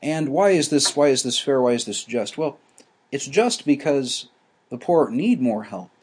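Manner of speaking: ordinary talk, nothing marked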